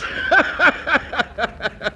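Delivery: high wolfish chuckle; lecherous chuckle. Acted. Boss laughing at the new masseuse. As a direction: chuckle at the pretty girl.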